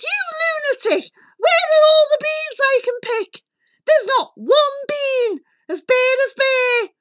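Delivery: very high, loud shrieking; furious scolding mother. A narrator's reading. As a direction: screech at the boy in exasperation. Mother scolds Jack in story.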